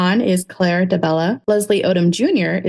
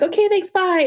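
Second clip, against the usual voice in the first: much higher, rushed goodbye; flustered squeak; 12 semitones higher than usual. Retold or imitated. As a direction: blurt it out starstruck. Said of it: "I was like".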